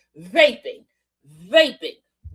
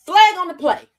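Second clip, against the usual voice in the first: loud, higher call of a foul; mock referee call; 4 semitones higher, 4 dB louder than usual. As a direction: throw the flag on her. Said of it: "Mister Lieu was like".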